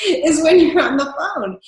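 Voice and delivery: smiling customer service voice; mildly exaggerated phone manner; fake phone voice. Shown as a demonstration. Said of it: "putting on my fake phone".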